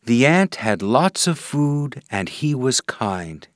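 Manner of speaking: ordinary delivery, nothing marked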